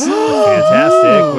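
excited gasp. Real, not acted. Big hit lands in game.

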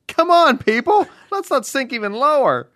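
loud, high indignant appeal; exasperated scolding. Acted.

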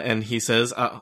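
ordinary talk, nothing marked

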